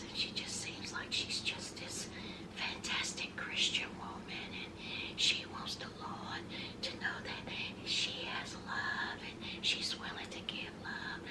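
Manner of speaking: airy, hushed churchy whisper; soft whisper impression. Mocking Shauna's soft voice, in a hushed whisper. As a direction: breathe it out like a sweet pious lady. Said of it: "because she talks like this".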